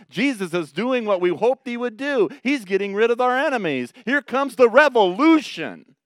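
imagining the disciples' rising hope; excited disciples. Retold or imitated.

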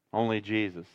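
ordinary talk

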